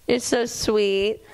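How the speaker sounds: ordinary talk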